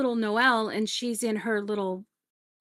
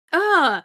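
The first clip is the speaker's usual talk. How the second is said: higher, louder revolted grunt; disgusted ugh; 7 semitones higher, 8 dB louder than usual. Retold or imitated. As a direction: recoil from the taste. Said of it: "she's like".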